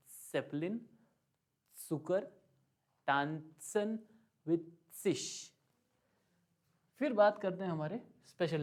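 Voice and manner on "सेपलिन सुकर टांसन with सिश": सेपलिन, सुकर, टांसन, सिश with tongue tapping up for ts; German Z words. Shown as a demonstration. Teacher repeating Z examples for students, deliberate ts. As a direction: separate words, each Z a crisp ts. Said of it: "जीभ ऊपर touch हो रही है".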